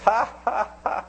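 high, loud mock laugh; imitated laugh. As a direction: mimic a big lady's high-pitched "ha, ha, ha". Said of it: "looked at me and laughed".

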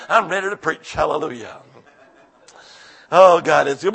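normal talking voice

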